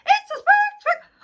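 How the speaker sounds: shrill very high loud squawk; excited hen squawk. Acted. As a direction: explode like a thrilled hen.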